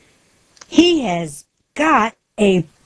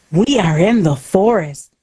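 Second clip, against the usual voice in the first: plain reading voice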